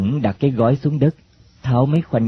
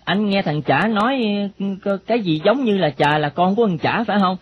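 high-pitched youngster's questioning; inquisitive boy; 9 semitones higher than usual. Acted. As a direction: probe the girl in a raised boyish voice.

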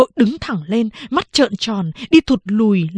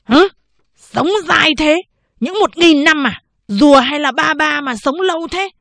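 incredulous; 7 semitones higher, 4 dB louder than usual. Acted. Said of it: "trợn mắt".

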